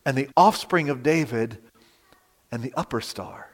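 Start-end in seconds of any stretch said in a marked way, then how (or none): 2.5-3.5 fading to a breath on star; awed hush. Real, not acted. Preacher awed by the bright morning star, fading to a hush. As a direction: let it die away into awe.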